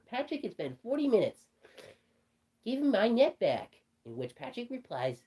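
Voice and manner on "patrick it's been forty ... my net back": annoyed. A narrator's reading. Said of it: "SpongeBob was extremely annoyed".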